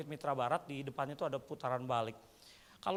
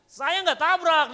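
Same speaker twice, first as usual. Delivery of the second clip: high, drawn-out protest with falling tone; loud driver's protest, dropping at the end; retold loud denial; 13 semitones higher, 14 dB louder, much slower than usual. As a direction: shout back a denial, slowly. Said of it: "nadanya turun kan?".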